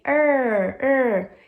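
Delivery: "er" loud and drawn out with an English R; long voiced "er" demonstrated for students; isolated R sound. Shown as a demonstration. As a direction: sound out "er, er", each falling in pitch. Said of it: "É um R".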